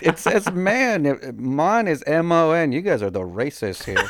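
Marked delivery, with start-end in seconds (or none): 0.0-1.0 MAN high and drawn out, flat "a"; protesting, long high MAN; stress on MAN. Real, not acted. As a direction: insist on MAN, stretched slowly and pitched up. Host repeats the correction.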